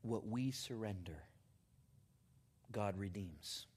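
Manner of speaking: hushed, low, reverent delivery; quiet, earnest sermon climax; solemn testimony preaching. Acted. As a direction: let it sink in softly, with long pauses.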